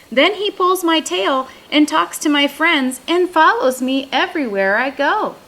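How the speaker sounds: put-on young raccoon complaining. A narrator's reading. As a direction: whine it out like a put-upon big brother.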